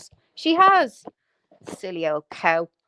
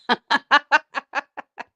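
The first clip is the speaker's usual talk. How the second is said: long, higher string of chuckles; mocking laughter; 4 semitones higher, 3 dB louder than usual. Real, not acted. Ranter laughing at how dug up she looks, mocking laughter. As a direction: laugh away at your own jibe.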